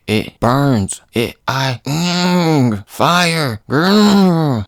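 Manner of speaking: hammed-up pained transformation; acted burning pain. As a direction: struggle through pretend agony into a growl. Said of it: "starts to feel all this pain".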